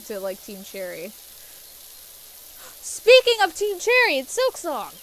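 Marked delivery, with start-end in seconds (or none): 2.8-4.9 excited. Real, not acted. Fan excited about Silksong figure.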